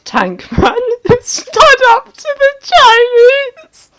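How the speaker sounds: cracking up, high and loud; bursting into laughter mid-read; laughing. Real, not acted. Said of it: "I can't read these comments with a serious voice".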